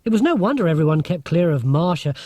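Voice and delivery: ordinary delivery, nothing marked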